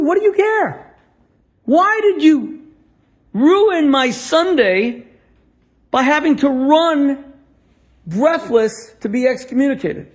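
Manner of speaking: high, loud, slowed and deliberate; impassioned challenge. Retold or imitated. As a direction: hammer each phrase at him.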